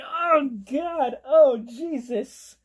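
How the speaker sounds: high, loud, drawn-out horrified groan; creeped-out exclamation, stretched and rising in volume; disturbed reaction. Retold or imitated. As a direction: reenact recoiling in horror. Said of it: "that disturbed the fuck out of me".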